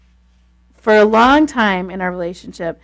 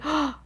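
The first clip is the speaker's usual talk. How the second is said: sharp mock gasp, then a high, soft oh; acted startled intake of breath; caught-out gasp; 6 semitones higher, 9 dB quieter than usual. Acted reaction to being caught.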